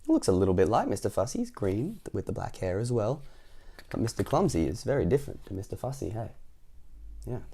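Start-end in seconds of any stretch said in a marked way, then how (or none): none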